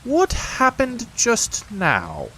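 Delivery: slow, high and self-important; solemnly. A narrator's reading. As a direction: Rabbit playing the stern judge, slowly. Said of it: "he said solemnly".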